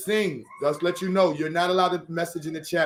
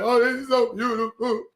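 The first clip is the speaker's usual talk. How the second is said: higher, half-joking overcome voice; a touch of mock weeping joy; mock inner crying; 7 semitones higher, slower than usual. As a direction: play the teary inner voice, lightly.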